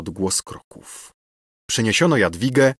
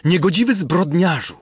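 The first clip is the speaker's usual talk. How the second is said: much higher indignant insult; outraged cry; 8 semitones higher, 3 dB louder than usual. Acted. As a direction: condemn him with fury. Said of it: "zawołała księżna".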